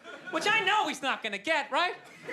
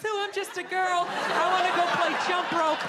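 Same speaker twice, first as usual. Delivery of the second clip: put-on little girl voice; 5 semitones higher, 3 dB louder than usual. Feminist turned little girl.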